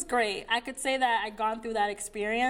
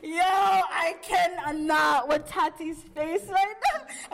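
laughing; 5 semitones higher, slower than usual. Real, not acted. Cracking up at friend's face.

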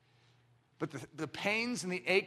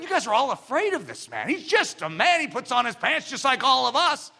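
mock joking Morris; 7 semitones higher, 6 dB louder than usual. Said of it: "Gouverneur Morris was joking".

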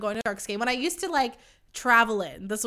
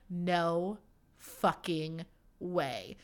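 stunned disbelief; 4 semitones lower, 6 dB quieter, much slower than usual. Retold or imitated. Learning the guy is Australian.

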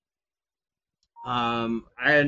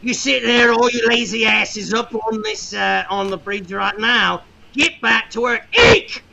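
building from gruff scolding to a high, loud screaming fury; angry pirate boss; 6 semitones higher, 7 dB louder than usual. Acted. Plug screams at the lazy crew on the bridge, furious. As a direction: tear into the slackers until you are screaming.

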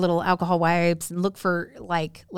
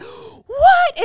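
very high, loud gasped squeal; mock shock; 7 dB louder than usual. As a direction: imitate a scandalised mom's disbelief. Mom shocked at stolen microwave.

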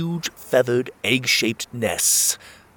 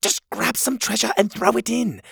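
higher, lightly voiced girl; a hint of a bright young helper; Cogleen's voice; 12 semitones higher than usual.

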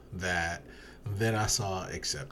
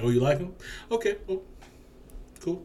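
mock casual breakup; 5 semitones higher, faster than usual.

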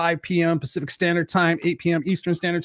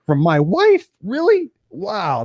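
surprised disbelief; 9 semitones higher, much slower than usual. Retold or imitated. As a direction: pitch leaps up on Really. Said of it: "I was like".

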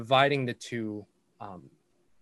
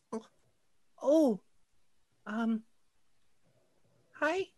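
put-on shy little girl; 13 semitones higher, slower than usual. Shy girl caught by friends.

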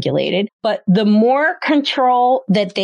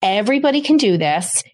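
usual speaking voice

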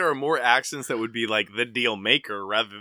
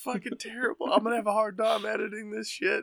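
much higher, giddy and amused; giddy disbelief; 10 semitones higher than usual. Real, not acted. Reacting to a crude joke.

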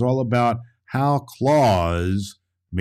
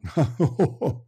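amused laugh; 6 semitones higher than usual. Real, not acted. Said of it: "It's a funny one".

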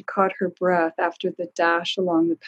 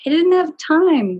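put-on much higher, louder childish whine; whining boy; 10 semitones higher, 6 dB louder than usual. A narrator's reading. Boy excusing himself to his father, whining. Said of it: "whined the boy".

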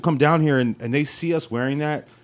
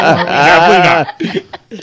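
laughing it off, slow and drawn out; 9 dB louder, much slower than usual. Real, not acted. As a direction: chuckle through a drawn-out no. Emphatic laughing denial.

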